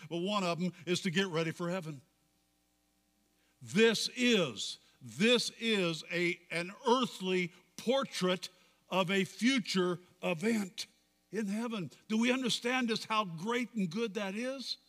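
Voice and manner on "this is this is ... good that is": raised, emphatic pulpit delivery; fervent preaching. Real, not acted. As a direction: drive each point home to the congregation. Preaching rises to full force.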